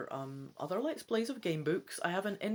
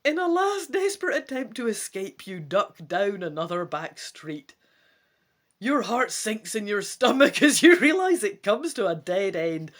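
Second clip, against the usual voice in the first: dramatic gamebook narration; story narration; 8 dB louder than usual. A narrator's reading. Reading the gamebook to viewers, dramatic narration. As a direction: read it out like an adventure story.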